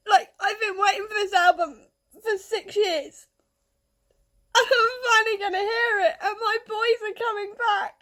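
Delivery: crying with happiness as you speak; happy tears. Real, not acted. Fan telling viewers her band is back, tearful. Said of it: "Hence the tears".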